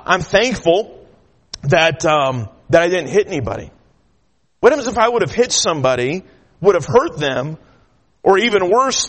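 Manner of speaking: ordinary talk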